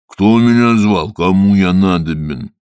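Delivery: slow, loud rumbling spirit; gruff water spirit. Acted. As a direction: demand it gruffly. (грубый голос Водяного)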